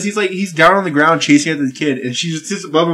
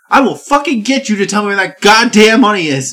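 loud, raised-pitch acted threat; mock angry threat; 7 semitones higher, 5 dB louder than usual. Preacher threatening kids over hidden money, mock furious. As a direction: play the menacing preacher, loud and insistent.

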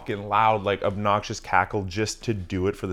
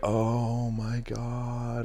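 long drawn-out, softer groan of dismay; exasperated; 3 semitones higher, 6 dB quieter, much slower than usual. Retold or imitated. Driver reacting to date screaming out the window, drawn-out exasperation. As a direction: stretch every word out in weary disbelief. Reacting to her embarrassing yelling.

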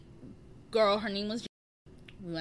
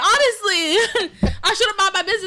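laughing hard; 10 semitones higher, 9 dB louder than usual. Real, not acted. Cracking up after the story.

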